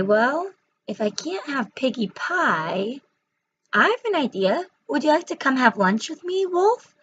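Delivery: sly witch voice rising high at the invitation; scheming witch. Acted. Witch inviting the hungry wolf to lunch, sly rising witch voice.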